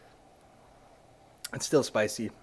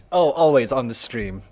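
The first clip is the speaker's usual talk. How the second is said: usual speaking voice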